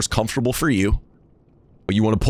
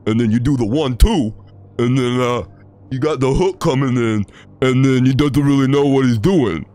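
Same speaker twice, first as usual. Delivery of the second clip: Rocky voice; 2 semitones higher than usual. Shown as a demonstration. Streamer demonstrating the Rocky voice in full for chat. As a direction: mumble like a punch-drunk boxer. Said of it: "how to do that".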